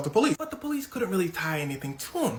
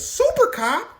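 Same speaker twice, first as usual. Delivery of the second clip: loud, high-pitched astonished question; shocked disbelief; 6 dB louder, slower than usual. Retold or imitated. Everybody hears Super Cop was involved, shocked. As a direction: exclaim it high, as a stunned crowd.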